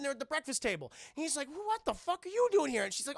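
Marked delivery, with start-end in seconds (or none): 1.5-2.8 high, loud shocked demand; shocked outburst. Retold or imitated.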